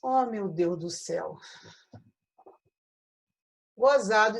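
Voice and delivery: ordinary delivery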